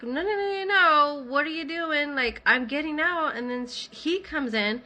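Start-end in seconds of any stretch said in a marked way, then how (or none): 0.0-3.4 high, raised, alarmed retold protest; panicked protest. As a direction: fend them off in rising alarm. Retold panic at being grabbed.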